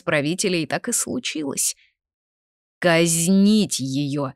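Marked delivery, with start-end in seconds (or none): 2.8-4.3 very slow, drawn-out order; royal command. A narrator's reading. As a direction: pronounce the sentence, stretching each word. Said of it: "приказал король".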